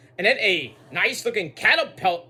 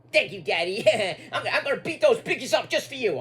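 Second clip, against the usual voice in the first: giggly daughter; 4 semitones higher than usual. Pretend.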